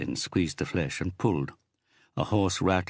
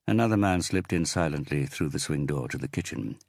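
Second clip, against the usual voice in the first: plain reading voice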